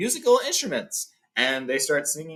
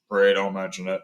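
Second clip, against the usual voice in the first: grunt; 6 semitones lower than usual. Retold or imitated. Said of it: "until Thorin grunts".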